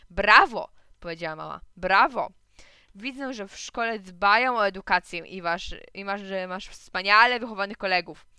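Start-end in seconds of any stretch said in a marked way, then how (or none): 0.1-0.7 loud, biting mock applause; sarcastic praise. A narrator's reading. 1.8-8.3 loud, emphatic mock admiration; mother pretending to be impressed; sarcastic praise. A narrator's reading.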